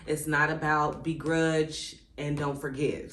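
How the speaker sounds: normal talking voice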